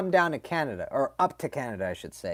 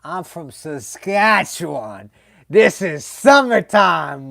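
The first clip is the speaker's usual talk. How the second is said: slow, louder, cocky drawn-out voice; Saskatchewan buddy; 4 semitones higher, 9 dB louder, slower than usual. Retold or imitated. Guy from Saskatchewan telling the dad it's summer, slow and cocky. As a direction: boast slowly like winter is nothing. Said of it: "He goes".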